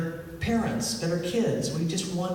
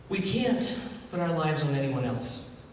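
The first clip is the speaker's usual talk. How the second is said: ordinary talk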